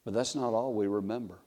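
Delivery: usual speaking voice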